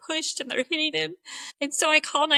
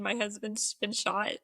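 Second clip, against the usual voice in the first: normal talking voice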